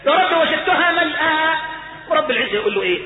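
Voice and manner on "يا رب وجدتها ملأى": high, slow cry to God; emphatic plea. Acted. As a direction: voice the servant pleading insistently. يعيد شكوى العبد بانفعال.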